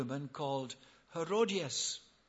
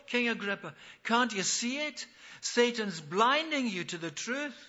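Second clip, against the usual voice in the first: high, loud, urgent appeal; impassioned preaching; 4 semitones higher, 5 dB louder than usual. Acted. Paul pleads with King Agrippa to see the truth, impassioned preaching. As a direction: press him hard with raised voice.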